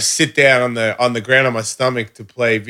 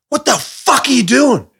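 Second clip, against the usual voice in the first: high-pitched furious demand; mock enraged confrontation; imitated angry partner; 13 semitones higher, 3 dB louder than usual. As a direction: act out her scolding outburst. Voices angry partner in quarrel.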